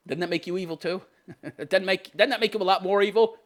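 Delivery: ordinary talk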